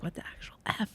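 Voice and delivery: whispered under the breath; under your breath. Shown as a demonstration. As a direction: mutter it so the kids can't hear. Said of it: "everything's under your breath".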